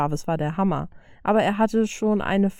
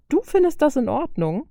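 aghast; 7 semitones higher than usual. Acted. Tony asks Bruce if he's fine with it, aghast. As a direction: recoil in disbelief. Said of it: "fragte er entgeistert".

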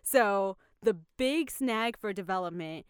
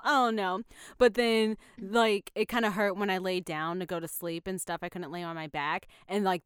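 usual speaking voice

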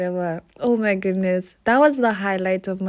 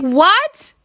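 loud, high disbelieving what; shocked what; 8 semitones higher, 6 dB louder than usual. Retold or imitated. Told she needn't pay for chips, loud surprised what. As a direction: jolt up in disbelief. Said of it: "I was like".

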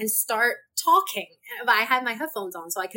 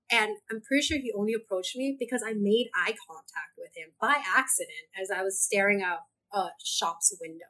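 usual speaking voice

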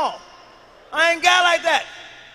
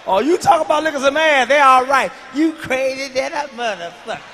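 shouted teasing over laughter; loud laughing heckle; 9 dB louder than usual. Real, not acted. Friend hollering at the comic while laughing. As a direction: holler at him while cracking up.

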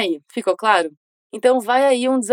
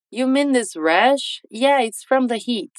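slow, clear dialogue read; slow model line; slower than usual. Shown as a demonstration. Teacher reads James's reply slowly to students. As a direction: read it slowly for learners to repeat. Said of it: "O James responde a Sarah".

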